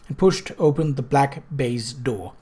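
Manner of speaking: plain reading voice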